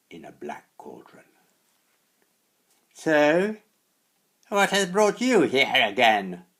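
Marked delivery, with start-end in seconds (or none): 2.9-6.5 high, loud screeching hag; witch's screech. A narrator's reading. Old hag screeching at the hungry boy, high and loud. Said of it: "Wart-Nose used to screech".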